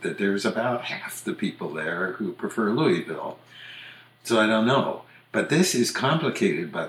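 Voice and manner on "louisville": LOUISVILLE as LOO-ee-vil; Louie pronunciation. Shown as a demonstration. Quoting the opposite camp, Louisville as LOO-ee-vil. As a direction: keep an EE in the middle of Louisville. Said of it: "argue the opposite".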